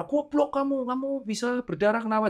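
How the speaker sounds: high mock angry insult; imitating a parent's angry outburst. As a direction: act out the parent who snaps at once. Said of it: "kita nggak bisa tiba-tiba marah".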